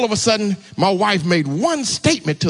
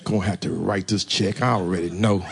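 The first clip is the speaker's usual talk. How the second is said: much lower, much softer laughing grumble; mock grumbling; 12 semitones lower, 7 dB quieter than usual. Pastor imitating himself dreading the tax check, laughing mock grumble. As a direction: sigh it out like a sulking man. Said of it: "I was still grumbling".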